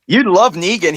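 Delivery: usual speaking voice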